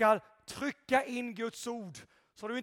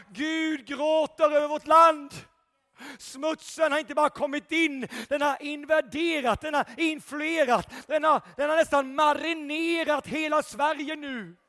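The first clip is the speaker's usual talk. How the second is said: loud, rising sermon delivery, building to shouts; fiery preaching; 4 semitones higher, 6 dB louder than usual. Acted. Preacher rousing the church, shouting praise. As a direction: preach it at full force to the congregation.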